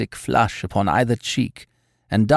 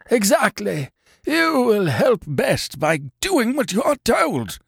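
high, strained, slow command; masterful; 10 semitones higher than usual. A narrator's reading. Sick man telling his helper to obey, masterful. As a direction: insist on obedience, word by word. Masterful patient gives orders.

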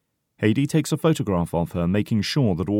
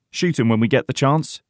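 ordinary delivery

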